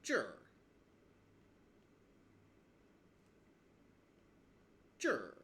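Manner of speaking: 这儿 said as one syllable with the R curled on; Beijing R demo. Shown as a demonstration. Teacher to learners, demoing the Beijing R on 这儿. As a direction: curl 这儿 into a single R syllable. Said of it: "Or you can say".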